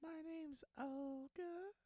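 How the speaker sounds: slow, high, drawn-out Olga; a slow, high seductive opener. Pretend. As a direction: stretch every word out slowly. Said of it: "what's with the timing of that".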